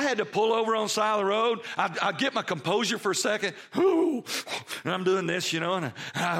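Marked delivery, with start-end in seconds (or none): none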